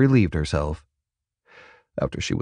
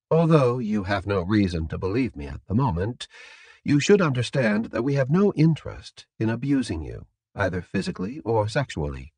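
soft, matter-of-fact captor; composed captor; 2 semitones higher, 4 dB quieter, faster than usual. Acted. Captor assuring prisoner of no abuse, soft and composed. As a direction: reassure the prisoner quietly and formally.

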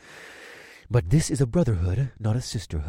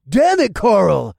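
slow, loud, higher furious yell; 8 semitones higher, 8 dB louder, much slower than usual. A narrator's reading. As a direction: bellow the name out in anger. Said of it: "Boyd yelled".

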